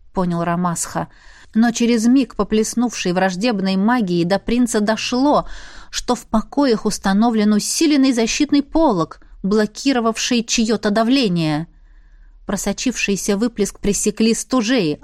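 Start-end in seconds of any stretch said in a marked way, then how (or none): none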